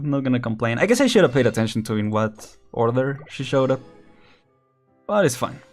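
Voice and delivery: normal talking voice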